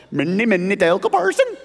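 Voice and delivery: mock Swedish Chef, jumping way up in pitch on the last word; lightly hammed-up Muppet chef voice; Swedish Chef impression. Preacher lightly hams up the inscription like the Muppet chef. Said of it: "like the chef".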